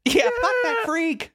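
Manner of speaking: very high, slow laughing shout; mock jeering kid laughing. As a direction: cackle it as a cruel kid. Joins jeering crowd imitation.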